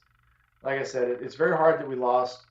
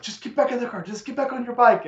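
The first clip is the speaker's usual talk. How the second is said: put-on pleading girlfriend; 9 semitones higher than usual. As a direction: beg him to leave it, pitched way up. Girlfriend voiced in story.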